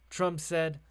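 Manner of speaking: normal talking voice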